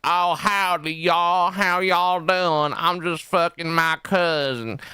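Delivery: high, drawn-out Southern drawl; exaggerated mock Southern US accent; southern American accent. Shown as a demonstration. Showing how easy it is, hammed-up southern American accent. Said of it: "a southern American accent, 'cause it's just talking like this".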